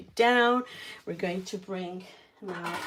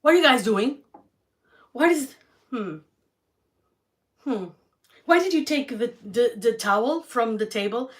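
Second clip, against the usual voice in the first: higher, much louder mock scolding mom; imitating mom; 5 semitones higher, 8 dB louder than usual. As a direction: bark it like a mom finding a mess. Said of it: "she goes".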